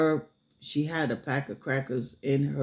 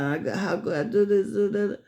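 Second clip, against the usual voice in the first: flustered rant; 8 semitones higher, faster than usual. Retold or imitated. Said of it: "she's like".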